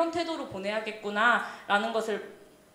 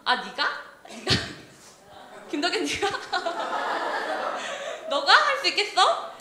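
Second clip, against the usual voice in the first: playful taunt broken by laughter; mocking; 7 semitones higher than usual. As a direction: jeer in disbelief, pitch climbing high. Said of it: "이렇게 그렇게 조롱을 하는 거죠".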